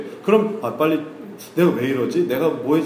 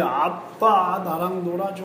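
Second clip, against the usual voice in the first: slow, drawn-out childish plea, lightly voiced; pleading child; 3 semitones higher, slower than usual. Pastor imitating his daughter tugging at him to play. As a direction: stretch it out like a little kid tugging at dad. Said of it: "지인이는 얼마나 이걸 해내려고 하는지".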